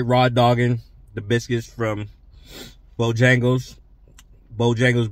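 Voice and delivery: eating between words; talking while chewing. Real, not acted. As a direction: mouth partly full of biscuit. Seen 3: Bitten biscuit held at mouth while talking; lips pressed, likely chewing.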